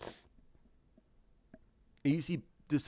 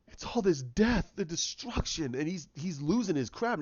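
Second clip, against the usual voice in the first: ordinary talk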